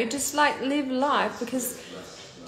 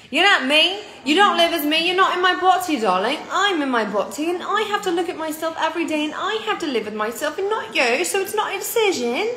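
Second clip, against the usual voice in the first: loud, higher, heated rant; angry rant; 5 semitones higher, 5 dB louder than usual. Real, not acted. Streamer snapping back at a rude commenter, angry. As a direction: tell the hater off, fired up.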